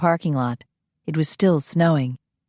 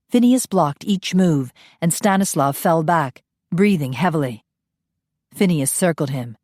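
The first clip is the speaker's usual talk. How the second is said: ordinary delivery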